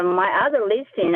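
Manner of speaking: normal talking voice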